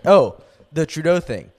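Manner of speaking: ordinary talk, nothing marked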